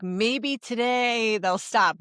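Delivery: slow, dreamy, hopeful voice; toxic hope. Retold or imitated. Mocking a parent's hopeless wish, slow and dreamy. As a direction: drag it out with naive hope. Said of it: "that toxic hope of".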